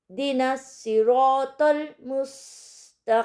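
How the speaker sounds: इहदिना सिरातल मुस्तकीम slowly in tajweed; slow recitation. Shown as a demonstration. Teacher recites the verse again for students, slow. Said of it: "हम पूरी आयत को पढ़ेंगे".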